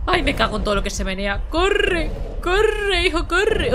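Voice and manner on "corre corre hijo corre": high-pitched urgent pleading; urgencia asustada. Real, not acted. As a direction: urge him on in a high panic. Urging game character to flee. (súplica aguda y apremiante)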